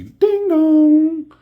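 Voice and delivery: high loud ding dong; doorbell imitation. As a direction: sing it like a doorbell.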